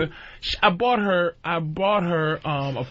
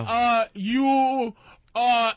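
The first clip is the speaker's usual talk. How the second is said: slow, higher mock broken English, words dragged out; 7 semitones higher, much slower than usual. Mocking the Brazilian girls' broken English, slow and higher. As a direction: imitate a Brazilian girl fumbling her English. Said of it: "'Cause the other ones be like".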